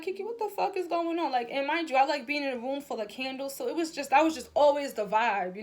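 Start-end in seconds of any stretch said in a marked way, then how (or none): 0.0-1.3 higher, mock-alarmed self-talk; hammed-up retold confusion; retold shock. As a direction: ask it in mock disbelief. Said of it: "'cause I'm like".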